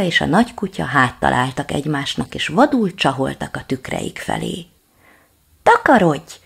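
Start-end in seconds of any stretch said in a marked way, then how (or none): 5.6-6.3 high, loud yell; little dog yelling to scare the reflection off; shout. Acted. Little dog shouts at the water dog. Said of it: "kiáltotta a kiskutya".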